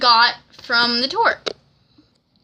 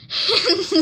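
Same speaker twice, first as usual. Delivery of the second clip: bright high laugh; high giggle; 8 semitones higher than usual. Real, not acted. Excited fan moment.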